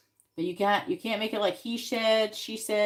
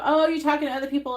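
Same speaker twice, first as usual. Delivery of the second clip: higher, louder, fast jealous jab; jealous accusation; 6 semitones higher, 7 dB louder, faster than usual. Retold or imitated. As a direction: fire it off like a suspicious boyfriend. Quoting jealous boyfriend's accusation.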